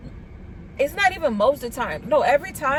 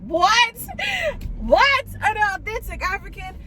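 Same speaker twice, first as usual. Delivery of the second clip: surprised shout; 8 semitones higher than usual. Real, not acted. Reacting to an authentic African.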